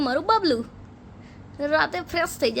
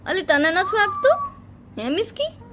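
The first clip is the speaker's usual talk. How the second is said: put-on playful baby talk; 8 semitones higher than usual.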